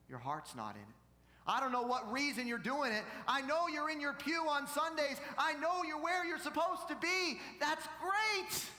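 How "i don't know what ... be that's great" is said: climbing from loud to a high shout; full-force preaching. Acted. Preacher voicing God to pew-sitters, preaching at full force. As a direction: drive it up to a shouted climax.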